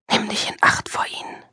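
high, urgent whisper; warning whisper. Acted. Inner voice warns Julia about colleagues, whispered. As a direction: hiss a warning close to her ear.

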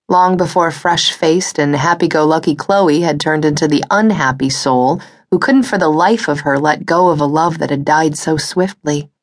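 ordinary delivery

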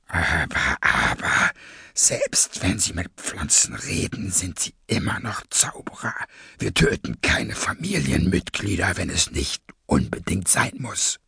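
low, breathy, measured menace; cold masked villain. Acted. Masked leader calms his gang, low and breathy. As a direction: soothe condescendingly in a low villain voice.

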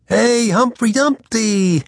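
slow playful mock shout. A narrator's reading. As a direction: tease him with a drawn-out call. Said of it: "Garth shouted".

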